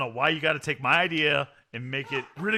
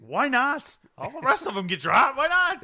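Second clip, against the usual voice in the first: higher, louder, fired-up defence; excited insistence; 10 semitones higher, 7 dB louder than usual. Real, not acted. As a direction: push back with giddy excitement. Defends his idea, worked up.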